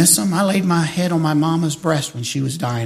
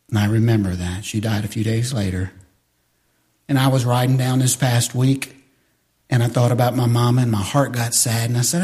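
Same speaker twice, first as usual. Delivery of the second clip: ordinary talk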